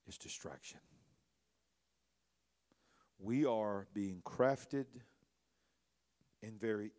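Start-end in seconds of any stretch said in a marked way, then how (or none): 0.1-0.8 hushed word. Real, not acted. Quiet climax of point.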